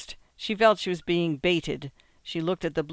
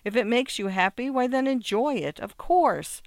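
excited urging, climbing higher as it goes; stimulated excitement; 6 semitones higher, 4 dB louder than usual. A narrator's reading. Wife urges secretary to enjoy working, excited. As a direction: gush encouragement with rising pitch. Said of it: "cried the wife with stimulated excitement".

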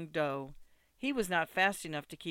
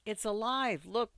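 higher-pitched exclamation; compassionate cry; 5 semitones higher than usual. A narrator's reading. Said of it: "cried Ida, shivering from compassion".

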